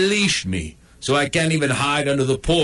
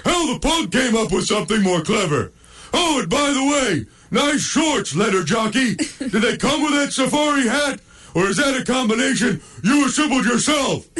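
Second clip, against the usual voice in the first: much higher, taunting dog voice; high mocking dog voice; 10 semitones higher than usual. Dog mocks the mailman's shorts, high and taunting.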